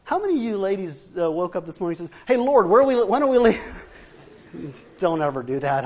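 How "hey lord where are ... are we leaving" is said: a nagging wife's loud call to her husband; hammed-up eager wife; mock eager wife. Wife pestering her husband to leave, loud mock call.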